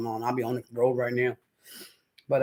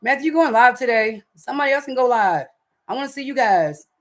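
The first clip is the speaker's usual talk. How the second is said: calling out; 9 semitones higher, 5 dB louder than usual. Real, not acted. Calling out to Matthew again.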